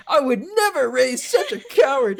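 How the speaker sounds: a mock-stern radio-drama mother; mock outraged mom. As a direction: play the appalled 1940s mom, over the top. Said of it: "And his mom's like".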